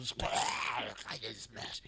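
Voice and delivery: ghoul voice. Shown as a demonstration. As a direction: drag it out like a ghoul. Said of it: "You should do it, like, in a ghoul voice".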